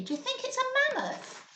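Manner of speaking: ordinary delivery, nothing marked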